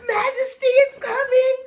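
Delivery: high, loud fake alarm; mock scary warning. Mom scaring daughter about the monster, mock alarm. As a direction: spook the kid with a pretend monster. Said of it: "I'm like".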